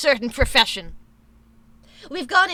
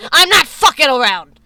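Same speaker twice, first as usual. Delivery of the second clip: loud; 10 dB louder than usual. Real, not acted. Threat to chat.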